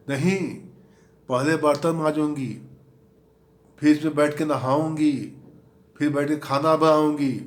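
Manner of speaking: mock sarcastic wife. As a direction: sneer it back as the irritated wife. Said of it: "उसका जवाब सुनिए अब". (पत्नी का ताना, ऊँची और तेज़ आवाज़ में)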